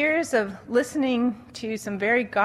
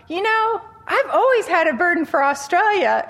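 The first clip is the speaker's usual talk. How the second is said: much higher, louder eager outburst; eager blurt; 8 semitones higher, 5 dB louder than usual. Retold or imitated. Woman at conference blurts her interest, eagerly high. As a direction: blurt it out with hopeful zeal. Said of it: "she blurted out".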